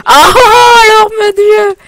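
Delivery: gémissement aigu et fort, très haut; cri de détresse. Real, not acted. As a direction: crie comme si on brûlait vif. Meurt brûlé dans le jeu. (cri plaintif et aigu)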